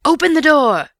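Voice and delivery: high-pitched furious shouting; loud, angry shout. A narrator's reading. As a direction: shout it angrily, demanding entry. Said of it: "loud, angry".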